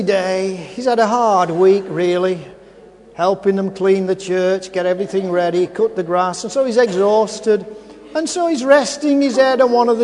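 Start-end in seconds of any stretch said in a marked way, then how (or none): none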